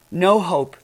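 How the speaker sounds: normal talking voice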